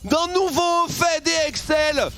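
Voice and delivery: shouted, high play-by-play hype; esports caster. Real, not acted. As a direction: scream the pentakill call like a caster. Caster hypes the winning teamfight.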